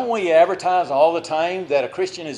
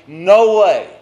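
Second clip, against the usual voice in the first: loud, defiant refusal; firm shout; 4 semitones higher, 8 dB louder than usual. Pretend. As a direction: shout down the temptation. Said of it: "we don't stop and say".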